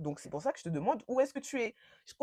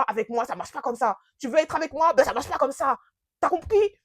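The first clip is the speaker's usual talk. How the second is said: imitated shouting rant; 3 semitones higher, 8 dB louder than usual. Boyfriend berating her in the airport, imitated angry shouting. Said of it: "il me criait dessus".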